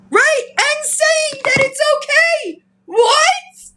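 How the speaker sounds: very high, drawn-out incredulity; indignant high-pitched wail of disbelief; outraged disbelief. Real, not acted. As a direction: squeal it out, appalled. Aghast at fraud being called okay.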